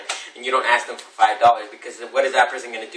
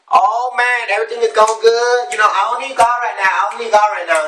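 high, breezy imitation of complacency; mock carefree; 8 semitones higher than usual. People who think they don't need God, mock carefree. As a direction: toss it off high, as if all is great.

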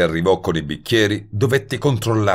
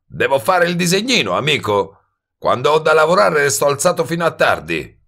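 high, sarcastic hustler; exasperated wise guy; 10 semitones higher, 4 dB louder than usual. Acted. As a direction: spell it out impatiently in a high, cocky voice. Voices Goebel, exasperated sarcasm. (sbruffone sarcastico, voce acuta)

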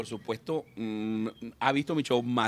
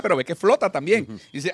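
ordinary talk

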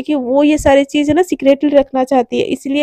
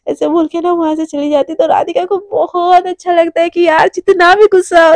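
ordinary delivery

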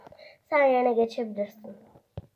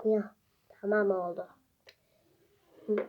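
ordinary talk, nothing marked

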